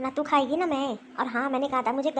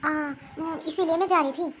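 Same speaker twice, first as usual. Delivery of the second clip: timid daughter-in-law; 3 semitones higher than usual. Acted. Daughter-in-law caught leaving, timid offer to mother-in-law. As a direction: caught out, hesitant young wife.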